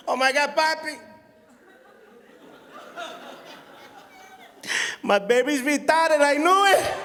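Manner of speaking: high, loud hammed-up wail building to a shout; mock screaming cry. Mom thinks son is sick, wailing shout. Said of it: "She starts screaming, crying again".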